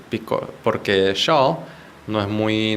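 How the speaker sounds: normal talking voice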